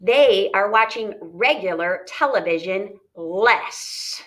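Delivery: slow, careful read-aloud; measured classroom read-aloud. Shown as a demonstration. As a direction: read each word deliberately to the class. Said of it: "All right, here we go".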